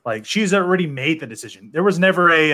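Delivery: usual speaking voice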